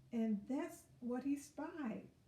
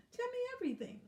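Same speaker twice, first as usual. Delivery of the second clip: much higher, kind invitation; gentle detective; 13 semitones higher, much faster than usual. Acted. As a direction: coax her to tell all. Watson comforts ladybug.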